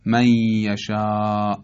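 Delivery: «من یشاء» as may-yashā, n merged into a long held y; idgham. Shown as a demonstration. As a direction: drop the ن of «من یشاء» and hold a doubled ی. («من یشاء» با ادغام نون در ی، با تشدید)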